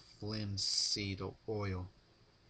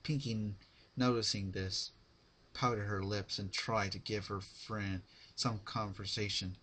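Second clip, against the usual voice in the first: ordinary delivery, nothing marked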